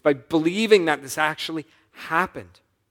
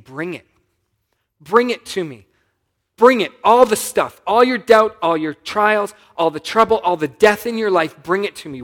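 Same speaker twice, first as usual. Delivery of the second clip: urgent plea; 5 semitones higher, 9 dB louder than usual. Acted. Preacher voicing Jesus pleading.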